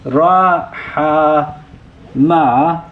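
Ra, Ha, Ma, each letter sounded separately; spelling out root letters. Shown as a demonstration. Teacher to students, sounding out the root Ra, Ha, Ma letter by letter. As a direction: spell the Arabic root Ra, Ha, Ma out slowly and distinctly. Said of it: "and this denotes".

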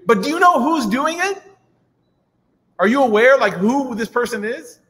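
urgent asking. Retold or imitated. Omar presses the woman.

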